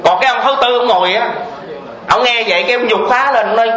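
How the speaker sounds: normal talking voice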